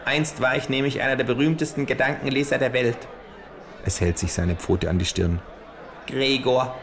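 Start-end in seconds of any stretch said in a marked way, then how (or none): 0.0-3.0 kangaroo voice. Acted. Kangaroo fanning itself with play money, smug higher voice. 6.1-6.8 higher, slow, dry smug kangaroo. Acted. Kangaroo fanning itself with play money, smug higher voice.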